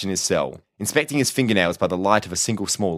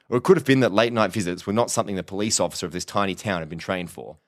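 ordinary delivery

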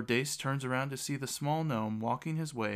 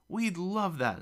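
high, mildly raised cry; eager shout; 8 semitones higher, slower than usual. A narrator's reading. Girl accepts the offer of books, eager shout. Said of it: "Elizabeth shouts".

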